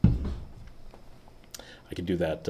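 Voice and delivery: slow breathy whisper; whispered aside. Real, not acted. Vlogger muttering to himself after his intro, slow whisper. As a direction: drop to a slow whisper, just to yourself.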